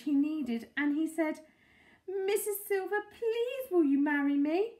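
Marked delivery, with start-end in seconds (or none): 2.3-4.7 higher, louder plea; earnest proposal. A narrator's reading. Mister Hoppy proposing to Mrs Silver, pleading. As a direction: pluck up courage and ask.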